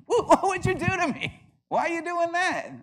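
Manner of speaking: high, loud yelp of complaint; hammed-up indignation, voice shooting way up; mock outraged protest. Pantheist complaining to whoever jabbed him, mock outrage.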